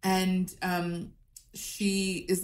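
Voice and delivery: ordinary delivery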